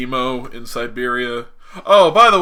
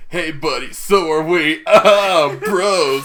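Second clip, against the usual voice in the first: loud buddy-bro banter breaking into laughs; lightly hammed-up bro voice; mock Bucky; 3 semitones higher, 6 dB louder, slower than usual. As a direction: play it as a hearty war buddy. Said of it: "Bucky sounds in my head".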